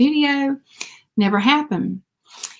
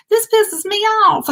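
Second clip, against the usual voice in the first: higher, heated mock rant; retold rant; 10 semitones higher than usual. Ranting about the shop on the phone to a friend, higher mock rant. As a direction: vent at the shop, half in jest. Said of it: "going off on the phone with Amanda".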